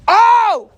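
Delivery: loud, high "oh!" outburst; sudden shout. Real, not acted. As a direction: cry out suddenly mid-sentence. Sudden outburst while telling the story.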